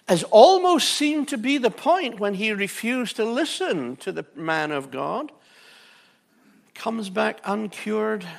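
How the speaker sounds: preaching. Acted. Preaching at full force.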